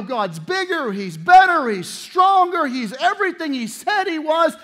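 triumphant testimony climbing to a peak; rising declaration. Acted. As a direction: build it up, each claim bigger.

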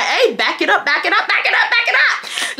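climbing mock shout to back off; rising back it up. To Wesley creeping inside, climbing high back it up.

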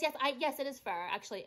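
ordinary talk

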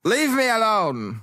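high, slow theatrical protest; petulant son. Acted. Son quarrels with his father.

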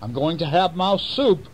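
high, greedy weasel voice; high hungry weasel threatening mouse soup. Acted. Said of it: "And the weasel said".

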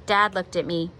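plain reading voice

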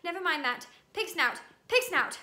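panting. A narrator's reading. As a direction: blurt the password, out of breath. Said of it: "panted Harry".

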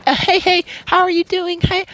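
high-pitched, sugary mock of a friendly girl; overly nice girl. Girl greeting him in the party, mock-nice. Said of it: "she was all nice".

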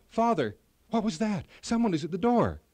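a frightened prince crying out; alarmed cry. A narrator's reading. Prince alarmed at a knock, crying out to his father. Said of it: "Prince Melchior cried".

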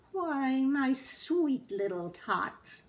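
lightly voiced, slow sugary liar; a hint of a syrupy fake Santa; sly fake Santa. A narrator's reading. Grinch fools the child, lightly voiced sweet lie. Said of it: "the fake Santa Claus lied".

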